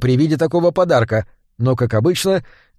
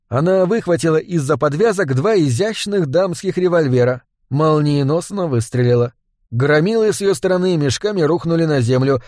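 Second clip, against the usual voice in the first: usual speaking voice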